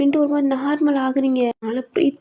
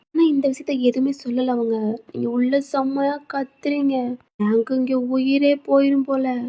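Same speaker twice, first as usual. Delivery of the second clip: ordinary delivery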